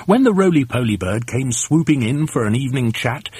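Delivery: plain reading voice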